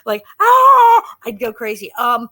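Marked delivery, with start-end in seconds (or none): 0.4-1.2 high, loud mock scream of panic; a short hammed-up yelp; panicked cry. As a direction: let out a pretend shriek. Imagined panic as child star.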